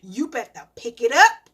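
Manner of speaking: put-on loud, stern warning to the kids; retold scolding. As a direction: lay down the law, raising the volume. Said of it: "I said".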